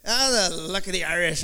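a touch of imitation Irish lilt; Irish accent. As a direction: slip into a casual mock Irish accent. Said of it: "I just start talking like Irish".

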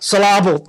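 playful, loud syllable stressed on the second beat; joke misplaced stress. Shown as a demonstration. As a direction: punch the middle of syllable, sil-LAH-bul. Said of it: "You stole my gag".

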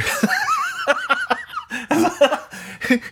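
high laugh cracking up; burst of laughter. Real, not acted. Cracking up at the catfish impression, high laugh. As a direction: laugh at your own impression.

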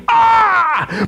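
high shouted "Ah"; panicked demon shriek; demon's cry. Shown as a demonstration. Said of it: "How do you translate".

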